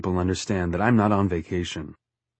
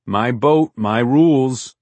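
higher, louder laying down of the law; firm captain's command; 4 semitones higher, 6 dB louder, slower than usual. A narrator's reading. Captain refuses whining Forty, firm command. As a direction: assert authority over the boat.

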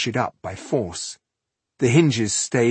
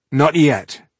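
higher, louder impatient snap; 6 semitones higher, 8 dB louder than usual. A narrator's reading. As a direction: cut him off impatiently. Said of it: "said Tungdil impatiently".

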